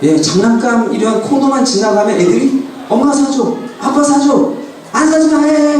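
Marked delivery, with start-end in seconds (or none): 2.9-5.8 lightly voiced pleading kids, higher; whiny child begging. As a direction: imitate a child nagging for toys, a bit higher.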